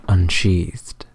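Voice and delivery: ordinary delivery, nothing marked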